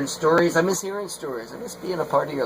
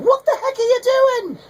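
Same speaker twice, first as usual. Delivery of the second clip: squeaky, much higher cartoon voice with a laugh; screaming muscles; 6 dB louder than usual. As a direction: give his sore muscles a tiny squealing voice. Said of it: "my muscles begin to scream at me, going".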